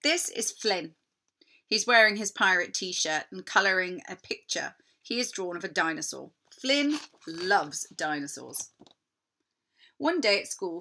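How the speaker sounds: plain reading voice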